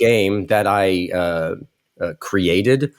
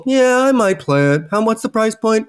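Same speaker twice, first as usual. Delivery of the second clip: bored lukewarm imitation; 3 dB louder than usual. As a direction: imitate a host who couldn't care less. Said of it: "you were like".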